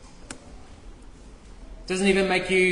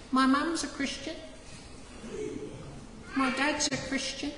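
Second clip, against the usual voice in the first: put-on higher, softer little kid excuse; whiny child voice; 6 semitones higher, 9 dB quieter than usual. Preacher mimics a child excusing themselves to God, higher and softer. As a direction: plead like a small child hiding behind parents.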